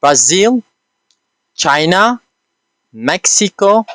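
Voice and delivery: Brazil, China, Mexico one by one, each with a rising list tune; pronunciation model. Shown as a demonstration. Teacher pronouncing countries once for learners, carefully spaced. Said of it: "pronounce all these countries".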